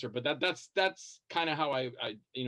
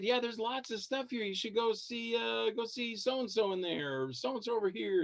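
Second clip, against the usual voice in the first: polite redirect; 6 semitones higher than usual. Retold or imitated. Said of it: "I said, I said".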